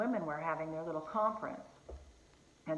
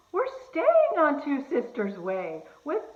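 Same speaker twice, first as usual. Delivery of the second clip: gasp, then higher, louder, slow astonished exclamation; amazed gasp; 7 semitones higher, 8 dB louder than usual. Retold or imitated. Old friend discovering she lives on the same street, gasping and astonished. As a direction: relive her astonishment in a big gasping rush. Friend realises they're neighbours.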